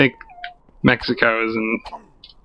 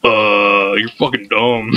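low, drawn-out dopey put-down; mock dismissive look; 5 semitones lower, slower than usual. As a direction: imitate a judging friend, pitched low. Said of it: "and you were like".

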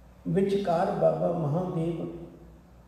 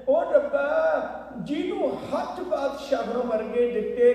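grandmother's tearful plea; 7 semitones higher than usual. Acted. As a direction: cry out to heaven for the poor boy. Grandmother cries out to God. (ਰੱਬ ਅੱਗੇ ਉੱਚੀ ਦੁਹਾਈ, ਭਾਵੁਕ)

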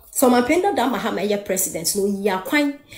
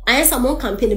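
usual speaking voice

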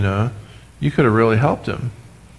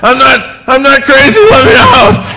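very high, loud mock desperate shriek; hammed-up frantic yell; 13 semitones higher, 10 dB louder than usual. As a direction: play a frantic man begging to be released. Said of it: "I'm like".

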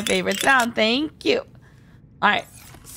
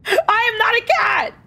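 mock outrage; 11 semitones higher than usual. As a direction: squeal it back at Danny in fake outrage. Chat teases her as a cat.

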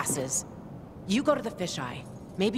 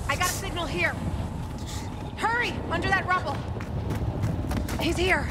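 urgent calling; 12 semitones higher, faster than usual. Acted. Found signal under the rubble.

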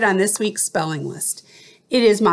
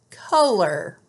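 teacher reading out spelling words; clear, careful dictation of each word; slow school dictation. Shown as a demonstration. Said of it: "I will read the word".

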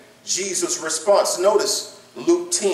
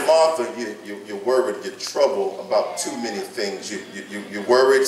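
tender Jesus; 10 semitones lower, 4 dB quieter than usual. Retold or imitated. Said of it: "He said".

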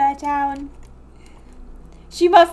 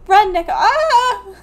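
panicked yell; 6 semitones higher, 6 dB louder, slower than usual. Acted. Fleeing the rival farmer.

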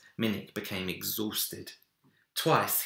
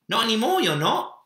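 put-on laughing boy; 8 dB louder, faster than usual. A narrator's reading. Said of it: "he laughed".

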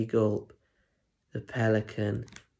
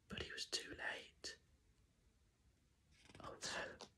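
breathy storyteller whisper, much softer; hushed whisper; 27 dB quieter than usual. Acted. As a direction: lean in and whisper the bad news. Storyteller hushes at the empty plate.

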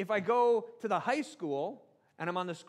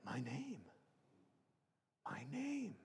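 Smeagol; 3 semitones lower, 10 dB quieter than usual. Acted. Gollum hears his forgotten name, hushed wonder. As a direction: murmur it softly, as if just remembering. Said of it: "Smeagol goes".

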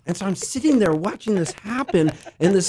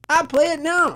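bad Australian accent; 12 semitones higher than usual. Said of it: "I do a terrible Australian accent".

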